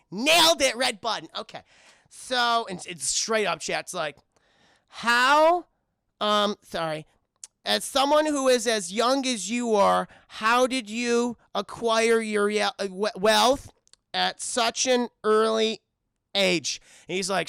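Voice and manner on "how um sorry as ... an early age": stiff reporter. Retold or imitated. [name] reading interview question.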